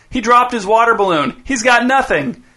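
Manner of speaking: put-on high, gloating kid's call. A narrator's reading. Dez gloats that Chip has nothing, high taunt. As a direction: crow it to your brother, slowing on the end.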